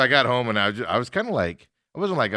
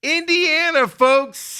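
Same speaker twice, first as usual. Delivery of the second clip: hype callout; 6 dB louder, much slower than usual. Acted. Host's hyped show-opening callout.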